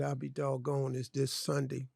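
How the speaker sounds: low-key retold self-talk, deflated; dejected muttering to himself. Talking to himself after hearing the phone message, quietly dejected. Retelling his dejected realisation.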